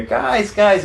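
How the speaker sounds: high, loud imitation of a rock star calling out. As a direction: call to the lads, high and loud, imitating him. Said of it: "and it's [name]".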